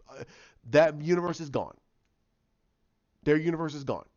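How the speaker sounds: ordinary talk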